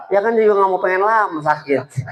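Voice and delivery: ordinary talk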